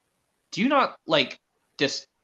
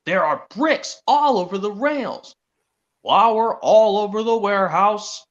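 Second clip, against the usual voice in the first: slow, louder scolding recital; stern boss; 5 semitones higher, 4 dB louder, slower than usual. Acted. Boy voicing the controllers listing the mess, slow and stern. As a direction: list the mess like a cross boss. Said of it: "Like this, where he's like".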